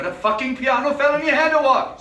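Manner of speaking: furious jibe at a fellow patient; angry outburst. Acted. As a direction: blast him for being so dim.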